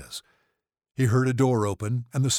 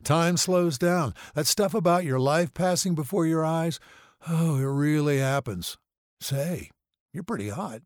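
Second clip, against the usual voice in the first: dazed, higher voice, drifting along; loopy on painkillers, pitched up; drugged burn patient; 8 semitones higher than usual. A narrator's reading. Drugged patient flirting with his nurse, dazed.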